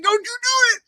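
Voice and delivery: a sitcom sibling warning the brother not to grab the hat, high and mocking. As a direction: squeak a cartoonish warning at the brother. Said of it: "You're like".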